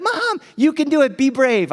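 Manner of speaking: high, punchy mom pep talk; encouraging mom. Retold or imitated. Wife urging son down the mountain, loudly encouraging.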